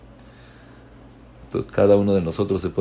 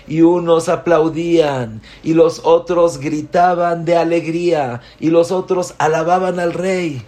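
rising, louder joyful telling of the cheering crowd; excited build; 8 semitones higher, 5 dB louder than usual. A narrator's reading. Preacher telling the congregation of the crowd cheering the king, excited and rising. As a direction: sweep them up in the celebration.

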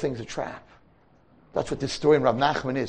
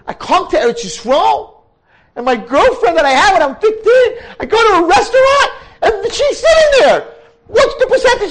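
much higher and louder, worked-up retelling; excited boy; 9 dB louder than usual. Retold or imitated. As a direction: tell it like a boy who can't believe it. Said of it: "he says".

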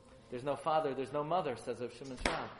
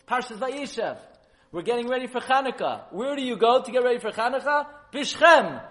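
rousing call; 8 semitones higher, 10 dB louder than usual. Acted. Yaakov's words, preacher building.

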